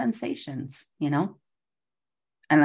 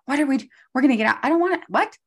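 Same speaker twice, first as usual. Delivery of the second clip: fast, higher rush of panicked thoughts; mock panic; 9 semitones higher, 4 dB louder, much faster than usual. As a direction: rattle it off like nerves taking over. Anxious inner voice backstage.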